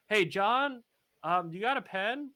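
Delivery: high, hurried friendly call. Acted. Character popping into a store, calling out to John. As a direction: pitch it up as if popping in to ask.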